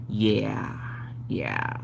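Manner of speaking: whispered yeah yeah. Real, not acted. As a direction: breathe it out softly. Whispered aside.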